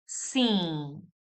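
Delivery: "sim" drawn out through the nose, lips open at the end; nasal model word. Shown as a demonstration. Teacher saying repeat after me, long nasal "sim". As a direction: say "sim" slowly with a nasal vowel and no M. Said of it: "Repeat after me".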